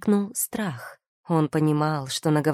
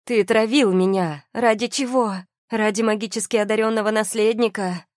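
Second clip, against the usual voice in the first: high, raised accusing questions; angry; 6 semitones higher, 4 dB louder than usual. A narrator's reading. Wife accuses husband of poisoning her, angry. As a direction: demand answers in outrage. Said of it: "Её голос дрожал от гнева".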